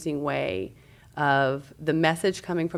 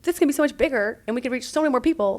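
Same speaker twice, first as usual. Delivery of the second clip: eager urging; 9 semitones higher, 7 dB louder, faster than usual. Retold or imitated. Urging Mary Flo excitedly.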